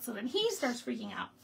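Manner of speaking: normal talking voice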